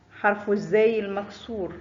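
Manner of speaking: ordinary talk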